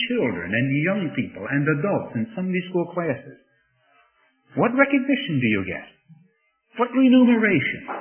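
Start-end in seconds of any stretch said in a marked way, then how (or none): 4.5-7.9 high, loud challenge; preacher challenging the teachers, loud and high; rhetorical outcry. Real, not acted. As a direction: throw the question out loud and high. Rhetorical question to Sunday teachers.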